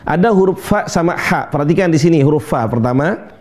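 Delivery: normal talking voice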